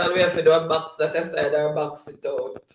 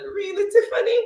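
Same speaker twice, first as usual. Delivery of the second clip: surprised; 4 dB louder, faster than usual. Real, not acted. Host reacts to Tiffany's comment.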